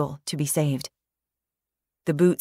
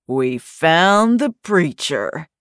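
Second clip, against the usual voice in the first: very slow, louder growling voice; ragged growl; 5 dB louder, much slower than usual. A narrator's reading. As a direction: growl it out like a brute. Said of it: "ragged, growling timbre of that voice".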